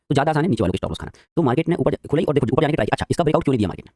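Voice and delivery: ordinary talk, nothing marked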